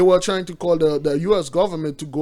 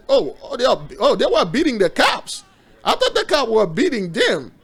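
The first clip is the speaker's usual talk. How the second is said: higher and louder, genuinely startled; surprised outburst; 5 semitones higher, 4 dB louder than usual. Real, not acted. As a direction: blurt it out in real surprise. Startled by video of fighting.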